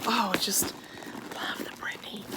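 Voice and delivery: whispered adoring sigh; dreamy whisper of delight; breathy swoon. Real, not acted. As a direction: sigh the words out like a secret love. Swooning over favorite bag.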